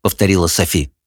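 ordinary talk, nothing marked

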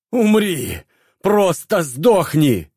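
high, slow desperate shriek. A narrator's reading. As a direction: shriek like a cornered rat. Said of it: "взвизгнул он".

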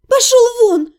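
рыдающий крик; sobbing shout. A narrator's reading. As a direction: throw him out through tears. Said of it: "прорыдала я".

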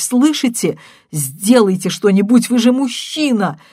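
loud, high and insistent; abandoned wife shouting at the husband; desperate shouting. A narrator's reading. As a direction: plead loudly, near sobbing. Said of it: "кричала она".

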